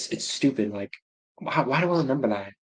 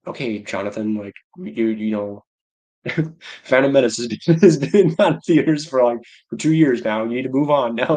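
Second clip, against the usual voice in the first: a touch of an exasperated parent, louder with laughter; imitating a parent; 6 dB louder than usual. Imagined parent telling Jonathan to move on, laughing. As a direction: lecture the kid half laughing.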